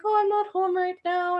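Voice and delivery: high, evasive little voice; nervous dodge. Retold or imitated. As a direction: squirm out of the question. Caught at boyfriend's place.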